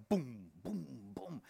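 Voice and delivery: low, soft booms, spaced like thuds; imitated heartbeat. Man hearing his heart in his ears, imitated heartbeat. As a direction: sound out a heart pounding in the ears. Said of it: "it's in my ears".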